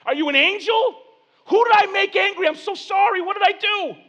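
very high, loud frantic questions; panicked defensive pleading. Acted. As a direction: plead at the top of your voice, scrambling to apologise. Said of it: "you're on the defensive".

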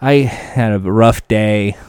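imitating a tired, beaten racer; exhausted. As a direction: sound drained after a long day. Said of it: "they are exhausted".

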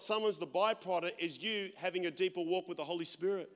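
ordinary delivery

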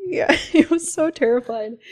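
laughing. Real, not acted. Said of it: "I'm choking and laughing".

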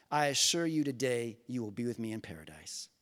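low, soft, solemn promise; gentle reassurance. Acted. Jesus answering the dying criminal, gentle reassurance.

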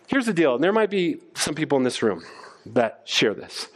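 normal talking voice

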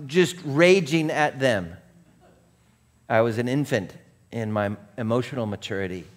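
ordinary talk, nothing marked

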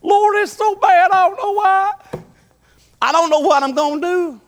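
high wailing self-pity; poor pitiful me. Retold or imitated. A man in a jam cries to God, high wailing self-pity. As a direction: whine it like you're sorry for yourself. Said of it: "All I had was".

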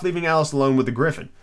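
ordinary delivery

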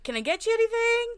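high, quick, eager offer; offering a sick coworker help; helpful coworker. Retold or imitated. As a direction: offer help brightly through the door. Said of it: "I think I would be like".

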